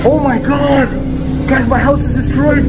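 high, panicked dismay; frightened and distraught, pitch way up; shaken. Real, not acted. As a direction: cry out in real horror. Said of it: "I'm literally shaking right now".

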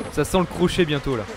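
ordinary delivery

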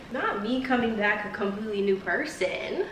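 normal talking voice

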